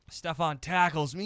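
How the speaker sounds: ordinary talk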